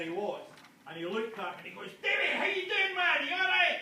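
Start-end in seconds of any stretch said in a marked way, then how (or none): 2.0-3.8 loud, high Scottish greeting across the room; Scottish shouted hello. Retold or imitated. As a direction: hail him in a big Scottish shout. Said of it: "Which is hello in Scottish".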